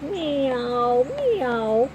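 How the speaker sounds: high rising meows; kitten meow. A narrator's reading. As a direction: mew from inside the basket. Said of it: "cries Bonesy from the basket".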